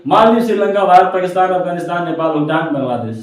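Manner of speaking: rattled off quickly in one breath; fast. Shown as a demonstration. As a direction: race through the list at speed. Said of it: "तो एकदम तेजी में बोलेंगे".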